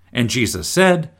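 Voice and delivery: normal talking voice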